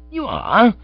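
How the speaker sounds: high, loud, startled question; gasped surprise. Acted. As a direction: gasp it out in shock. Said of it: "I gasped".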